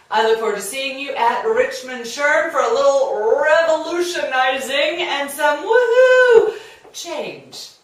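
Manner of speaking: building to a loud whoop; excited. Real, not acted. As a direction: ramp up to a gleeful woohoo. Upbeat sign-off invitation.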